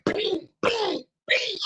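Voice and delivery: high imitated "bing, bing, bing" of punches; mock punch noises. Retelling a fight he lost, he mimics the punches in a high voice. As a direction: sound out each punch landing, high. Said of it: "I'm like".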